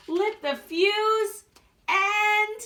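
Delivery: high, slow, rising suspense; building suspense. A narrator's reading. Narrator builds the kids up to the explosion, slow and high. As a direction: stretch it out, climbing to the bang.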